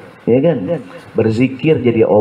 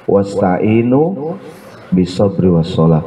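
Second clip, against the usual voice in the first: Quran recitation; 4 semitones lower, slower than usual. Acted. Quran verse recited slowly.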